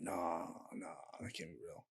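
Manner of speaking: hushed, disbelieving nah; barely there whisper of disbelief; stunned quiet denial. Retold or imitated. Telling a friend, hushed stunned denial.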